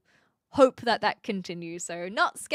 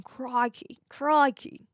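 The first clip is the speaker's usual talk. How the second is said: attempted Aussie catchphrase, rising louder; hammed-up Aussie exclamation; Steve Irwin; 4 semitones higher, 4 dB louder, much slower than usual. Shown as a demonstration. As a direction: try switching on an Aussie accent. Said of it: "Aussies are able to turn it on".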